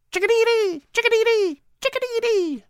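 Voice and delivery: high, sing-song bird call, grinning; chickadee call with a grin. Acted. Boy Patrick grinning as he chirps at the little bird. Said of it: "spoke Patrick with a grin".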